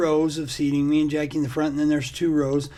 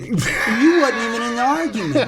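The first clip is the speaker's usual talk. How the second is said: higher, louder outraged repeat; indignant; 7 semitones higher, 4 dB louder than usual. Retold or imitated. Retelling mom's pinch to a friend, outraged repeat. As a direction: protest it with mock outrage.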